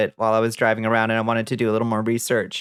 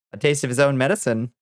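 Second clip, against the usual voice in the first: ordinary talk, nothing marked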